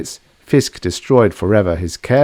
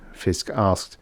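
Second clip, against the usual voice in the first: usual speaking voice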